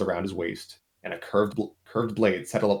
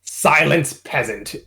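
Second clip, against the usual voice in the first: a louder, higher royal snap; snapped; 6 semitones higher, 5 dB louder, slower than usual. Acted. Queen Mary Sue snapping at Scout, a louder royal snap. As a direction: silence the peasant with a snap. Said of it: "snapped Queen Mary Sue".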